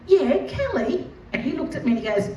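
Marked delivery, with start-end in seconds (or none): 0.1-1.1 high, slow and sweet, with a laugh; feigned innocence. Acted. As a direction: play dumb cheerfully. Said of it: "And I said to him".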